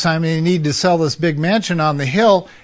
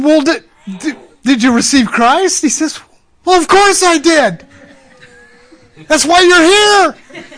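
high, loud, laughing exchange; giddy with joy; 11 semitones higher, 7 dB louder than usual. Retold or imitated. Counselor and mafia man laughing about his salvation.

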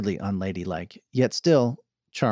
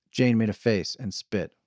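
ordinary delivery

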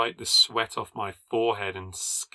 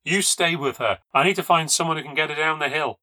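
raised, louder, insistent instruction; urgent order; 6 semitones higher, 4 dB louder than usual. A narrator's reading. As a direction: take charge in an emergency, voice pitched up. Urgent orders beside injured Rose.

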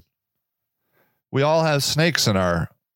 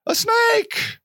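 mock panicked shriek; much slower than usual. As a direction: squeal it like a terrified tourist. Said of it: "And the people were like".

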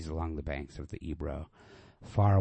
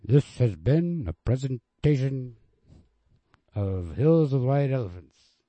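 higher, louder, slow closing announcement; announcer sign-off; 7 semitones higher, 8 dB louder than usual. Acted. Reader closing the story recording, announcer style. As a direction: announce the end of the reading. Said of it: "read by Adam".

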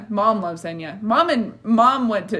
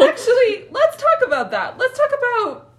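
high, loud teasing eagerness; excited; 5 dB louder than usual. Real, not acted. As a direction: push the topic eagerly.

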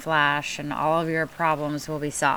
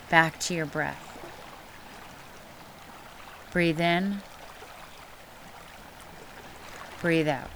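guided meditation; 4 dB quieter than usual. Acted. Leads guided breathing meditation.

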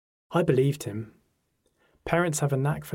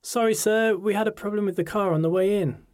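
put-on girl's voice; 8 semitones higher than usual. A narrator's reading. Tasha bursting in late.

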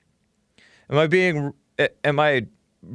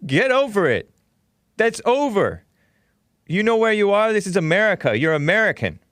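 a lightly hammed-up brush-off, higher and punchier; mock brusque retort; 6 semitones higher than usual. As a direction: fire it back like shutting someone down. Voicing Jesse Lee Peterson's retort.

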